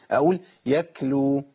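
يكلو said slowly with a whispered k sound; wrong Yaklu. Shown as a demonstration. Teacher demonstrating the mistaken reading. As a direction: swap the t for a breathy k in يكلو. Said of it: "بهمس في مخرج الكاف".

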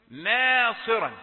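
ناصرا slow and loud with a heavy ص; careful ناصرا. Shown as a demonstration. Teacher modelling ناصرا slowly for the class, careful. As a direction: stretch the alif in ناصرا clearly. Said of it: "ولو قلت ناصر الناس يضحكوا".

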